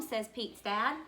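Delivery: plain reading voice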